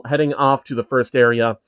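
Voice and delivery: ordinary delivery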